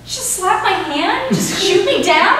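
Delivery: high, loud hurt outrage; hammed-up indignant sister; mock outrage. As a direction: shout out the betrayal. Staged quarrel.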